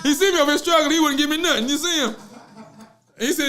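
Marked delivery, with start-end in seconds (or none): 0.0-2.2 high, loud playful complaint; mock outrage. Real, not acted. Host telling viewers producer watched him struggle, mock outrage. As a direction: appeal to witnesses, amused and aggrieved.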